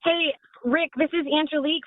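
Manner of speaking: ordinary delivery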